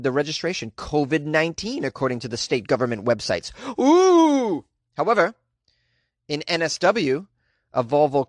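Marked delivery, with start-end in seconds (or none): 3.8-4.7 high, loud mock-eerie "oooh"; long ghostly hoot; spooky "ooh". Host teasing the covid plate mystery, spooky ooh.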